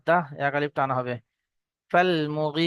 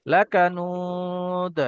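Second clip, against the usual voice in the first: Quran recitation; much slower than usual. Shown as a demonstration. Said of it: "shows qalqala on the final dal".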